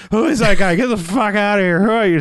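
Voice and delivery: high, loud, alarmed demands; hammed-up startled outburst; mock freak-out. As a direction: act out panicking at an intruder. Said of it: "who has this kind of fucking freak out?".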